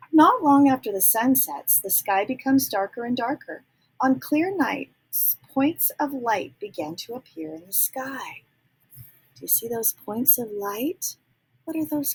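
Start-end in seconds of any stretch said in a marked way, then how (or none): 0.1-8.4 put-on reading to children. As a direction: read it out like a bedtime book. Reading the book text aloud.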